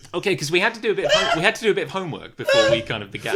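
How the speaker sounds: loud, fast, through laughter; laughing while talking. Real, not acted. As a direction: talk while cracking up. Laughing through the homework line.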